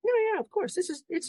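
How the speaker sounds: high-pitched dad. Retold or imitated. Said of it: "I'm like".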